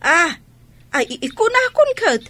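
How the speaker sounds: loud high shocked outburst. Acted. Mother hearing her daughter is pregnant, loud shocked cry. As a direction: burst out in disbelief.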